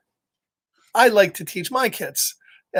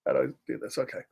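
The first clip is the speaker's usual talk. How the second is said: ordinary delivery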